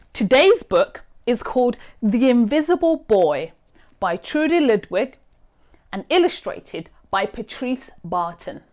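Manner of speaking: plain reading voice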